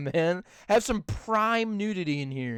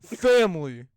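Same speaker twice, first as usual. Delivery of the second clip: Vin Diesel; 5 dB louder than usual. Acted. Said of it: "Vin Diesel standing right there".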